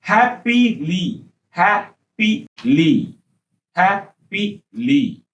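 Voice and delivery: slow repetition drill of happily. Shown as a demonstration. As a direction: stretch happily out slowly, syllable by syllable. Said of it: "repeated slow drill".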